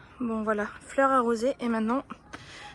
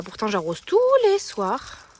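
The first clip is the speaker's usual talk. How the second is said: ordinary talk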